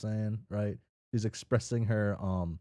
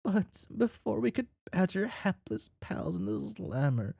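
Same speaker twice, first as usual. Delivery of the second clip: storytelling voice; 12 semitones higher than usual. Acted. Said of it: "get back into my storytelling voice".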